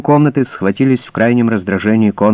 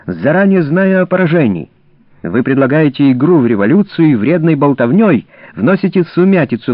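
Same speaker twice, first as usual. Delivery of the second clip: raised, higher indignant shout; angry shouting; 6 semitones higher than usual. A narrator's reading. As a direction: hurl the accusations angrily. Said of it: "закричал Конрад Шрам".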